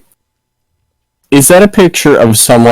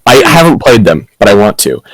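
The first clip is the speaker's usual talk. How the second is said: usual speaking voice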